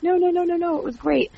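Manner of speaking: high-pitched, rapid-fire denial; imitated eager reassurance. Host voices boss brushing off her apology, eager reassurance.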